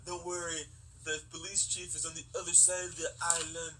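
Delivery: mock Jamaican accent, lightly done; a badly faked Jamaican accent. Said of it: "a very bad Jamaican accent".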